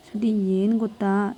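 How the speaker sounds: plain reading voice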